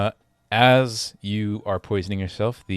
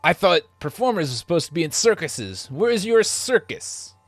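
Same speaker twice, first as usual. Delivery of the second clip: guard voice; 8 semitones higher than usual. Acted. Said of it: "the one up front says".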